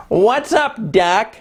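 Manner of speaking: lightly voiced cocky cartoon rabbit; smart aleck Bugs Bunny. Teacher quoting Bugs Bunny's catchphrase, smart-alecky. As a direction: toss it off like a wisecracking bunny. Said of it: "in kind of a smart aleck way".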